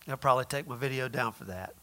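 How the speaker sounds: dropped low and soft, almost under the breath; hushed, very soft aside. Real, not acted. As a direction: duck the voice down low as if avoiding being overheard. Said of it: "that's why I had to say it way low".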